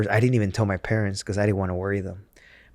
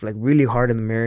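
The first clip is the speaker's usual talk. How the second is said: ordinary talk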